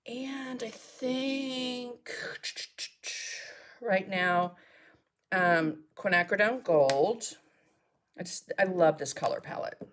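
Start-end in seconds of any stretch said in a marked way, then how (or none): none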